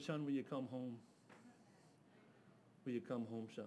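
low, soft gentle ask; father softly asking his son home; tender plea. Retold or imitated. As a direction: coax him home gently.

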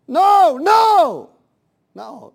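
loud, high shouted no; booming preacher's protest; emphatic rejection. Real, not acted. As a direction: shout it down, full voice. Preacher shouts down the weak prayer.

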